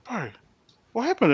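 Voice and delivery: high, startled asking what happened; a lightly voiced astonished brother-in-law; amazed question. Brother-in-law amazed after the talk.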